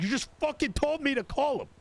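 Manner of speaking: high, exasperated retort in disbelief; exasperated outburst. Retold or imitated. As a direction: throw it back at her, fed up and incredulous. Retold exasperated reply to Tilly.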